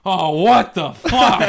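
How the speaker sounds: high, loud, drawn-out retold dismay; mock outrage. Comic imitates colleague thrown onstage unexpectedly, mock outraged. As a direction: wail it in exaggerated disbelief.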